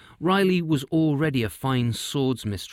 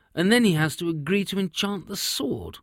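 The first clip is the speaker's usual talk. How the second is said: king voice; 7 semitones higher than usual. Acted. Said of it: "said the king".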